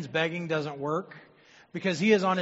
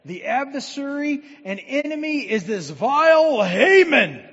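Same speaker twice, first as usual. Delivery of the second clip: dramatic accusation; 8 semitones higher, 13 dB louder than usual. Acted. Esther denouncing Haman dramatically.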